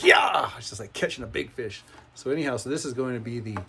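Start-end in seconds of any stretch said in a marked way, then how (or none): 0.0-0.5 a high, loud whoop of victory; triumphant yell. Retold or imitated. Builder retelling his win, shouting triumphantly. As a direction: shout it like you've just landed a big fish. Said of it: "and I was like".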